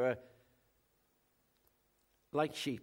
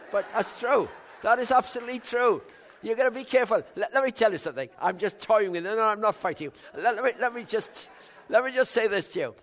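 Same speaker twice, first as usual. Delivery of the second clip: much louder, higher, driving preacher voice; full-force pulpit preaching, loud and pitched up; fiery preaching; 6 semitones higher, 9 dB louder, faster than usual. Acted. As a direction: pound the point at the congregation.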